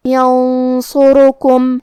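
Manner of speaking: ینصرکم slowly with the نون hidden in a heavy nasal hum before صاد; heavy nasal ghunna in ینصرکم. Shown as a demonstration. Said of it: "غنہ کو بھاری آواز میں ادا کرنا ہے". (ینصرکم میں بھاری غنہ)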